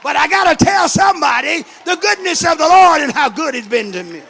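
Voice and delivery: loud, high, mounting sermon cry; full-force preaching. Real, not acted. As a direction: build into an impassioned shouted testimony.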